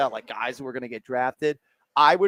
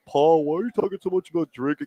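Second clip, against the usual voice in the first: mock chat viewer; 4 semitones higher than usual. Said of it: "some are saying".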